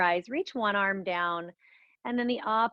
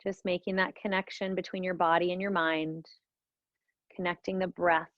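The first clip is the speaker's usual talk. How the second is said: guided meditation; 2 semitones lower than usual. Acted. Leads a guided breathing meditation.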